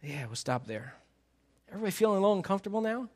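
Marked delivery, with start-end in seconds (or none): 1.7-3.1 quick teasing aside. Real, not acted. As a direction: tease them about the awkward verse. Teasing the squirming congregation.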